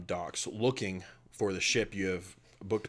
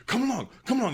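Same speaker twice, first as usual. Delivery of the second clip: much higher and louder, hurrying; bustling minotaur; 7 dB louder, faster than usual. Acted. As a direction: shoo them along cheerfully. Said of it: "he goes, he goes".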